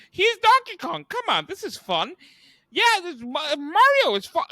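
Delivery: high, loud sarcastic pitchman voice; mocking the movie. Friend mocks the movie's pandering with hammed-up sarcastic excitement. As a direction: sell it like a pushy, fake-excited ad. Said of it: "it's just like".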